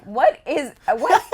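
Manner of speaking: loud, high, stumbling disbelief; flustered bafflement. Retold or imitated. Actress retelling a startling interview question, flustered. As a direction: act out being thrown by a question.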